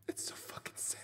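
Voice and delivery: hushed, voiceless whisper; whispered mock sadness. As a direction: whisper it like a private lament. Mock-sad performance winding down.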